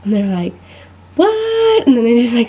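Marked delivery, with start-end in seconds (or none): 1.1-1.9 high, drawn-out mock disbelief; hammed-up shocked relative, pitched way up; astonished what. Relatives hear her major, astonished squeal of "what".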